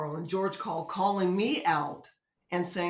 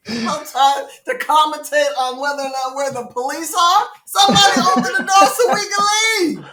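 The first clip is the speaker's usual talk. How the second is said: loud, higher, fast shout to open up; panicked shout; 8 semitones higher, 12 dB louder than usual. Retold or imitated. Stuck in the rocking van, shouting for someone to open the door. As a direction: yell desperately to be let out.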